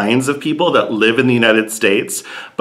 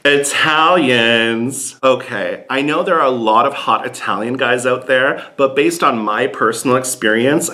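ordinary delivery

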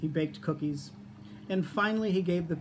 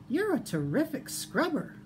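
high piglet voice, full of praise; 9 semitones higher than usual. A narrator's reading. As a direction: compliment the fox in a high small-pig voice. Piglet praising the fox in story.

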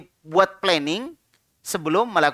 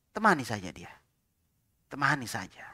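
soft whisper, no voice; hushed unvoiced reassurance; whispered advice; 8 semitones lower, 11 dB quieter than usual. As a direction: whisper it gently to a friend.

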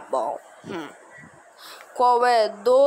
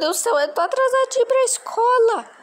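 put-on little boy voice; 13 semitones higher, 4 dB louder, faster than usual. Kid voicing late schoolboy son.

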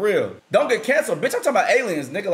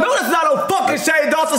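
shouted denial; 9 semitones higher than usual. Real, not acted. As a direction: shout him down. Arguing it's not Shane Dawson.

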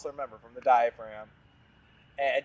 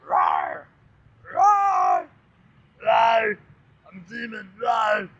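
high loud playful roaring; hammed-up demon roar; mock demon roar; 9 semitones higher, 6 dB louder, much slower than usual. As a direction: roar like a silly monster. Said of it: "It's a demon over there".

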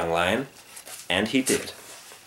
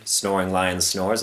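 ordinary delivery

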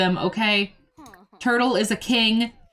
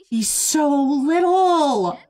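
higher, slow and louder coo; drawn-out coo; 6 semitones higher, 4 dB louder, much slower than usual. Real, not acted. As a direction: stretch it out, gushing over the puppy. Cooing over puppy, drawn out.

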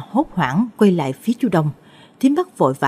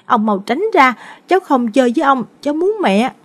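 put-on high-pitched little boy protesting; high, mildly shouted tantrum, acted; child bawling; 8 semitones higher than usual. A narrator's reading. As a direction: wail like an upset small child. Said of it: "gào lên".

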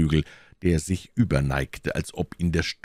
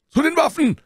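high-pitched alarm shout; Grimaud; 5 dB louder, faster than usual. A narrator's reading. Grimaud warns the musketeers of attack, shouting. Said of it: "schrie Grimaud".

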